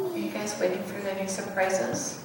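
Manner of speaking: ordinary delivery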